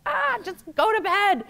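exasperated snap. Retold or imitated. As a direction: bark it out like a fed-up mom at bedtime. Frustrated mom snapping at her toddler.

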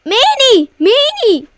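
yelling Meanie high and loud; loud shout. Real, not acted. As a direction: yell it out, hurt. Kid yelling at brother.

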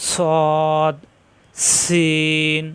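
সদ with a hissing whistle of air, held long; whistling letter. Shown as a demonstration. Teacher showing the class a whistling letter, hissed and drawn out. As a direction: let air hiss out through সদ. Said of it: "শীষ দিয়ে পড়া".

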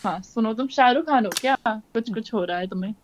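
soft, swooning, mostly hushed flirt; mock lovestruck hush.

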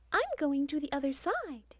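high, bratty little goat voice; nose in the air, announcing he's off; cocky baby goat. Acted. Little goat tells his big brothers he's leaving, high and cocky.

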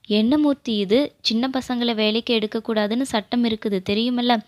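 usual speaking voice

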